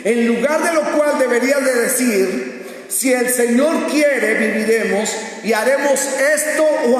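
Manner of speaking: preacher reading scripture with force; forceful scripture reading. Acted. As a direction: proclaim the verses high and emphatically. Reads scripture aloud forcefully.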